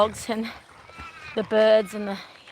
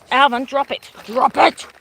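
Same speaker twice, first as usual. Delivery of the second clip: firm dog command; 3 semitones higher, 6 dB louder than usual. Real, not acted. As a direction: order the dog to drop it.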